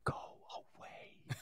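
silent mouthed words; unvoiced mouthing. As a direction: shape the words with no voice. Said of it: "I mouthed".

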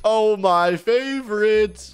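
high, loud, drawn-out mock delight; gushing delight. Inmate sees his favorite food, gushing delight. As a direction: stretch it out in hammed-up joy.

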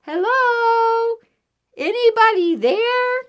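high, ringing call; shouting. A narrator's reading. Mouse calling into the hole for anyone, high shout. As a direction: holler high and long to whoever is inside. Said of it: "she shouted".

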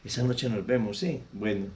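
husband sweet-talking his lover on the phone, low and soft. Acted. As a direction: murmur sweet nothings down the phone, then ask for the wife. Cheating husband calls home.

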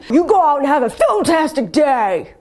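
loud, high hammed outro; loud sign-off. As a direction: belt the sign-off.

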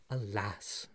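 low, soft, regretful word; sorrowful sigh. A narrator's reading. Nephew about to tell an ill story, sorrowfully. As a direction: let it fall softly with regret. Gamwell begins his sad tale.

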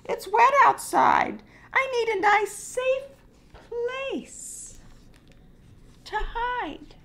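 very high, anxious hen. Acted. Hen begs for a safe place, very high voice. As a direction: squeak it high like a nervous hen.

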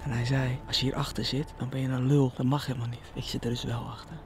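soft whisper while hiding; hush, the seeker is near. Real, not acted. Hiding behind bushes, whispering.

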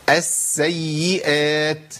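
السيئات very slow, long s and long y; both doubled letters held. Shown as a demonstration. Teacher showing all four letters, slow السيئات with both doublings held. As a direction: linger on the doubled sin and ya of السيئات. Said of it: "نجيب مثال".

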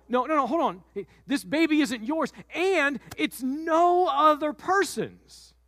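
high, drawn-out insistence; slow the last words down to land them; insistent Mary. Acted. Mary insisting, slowing at end.

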